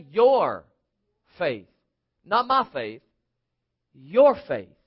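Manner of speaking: "Your" set apart by pauses and hit harder; stress on YOUR. Real, not acted. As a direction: punch "Your" against "my", then drop on "faith". Preacher contrasts your with my.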